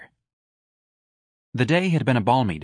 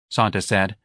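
ordinary delivery

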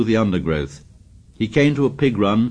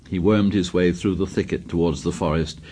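plain reading voice

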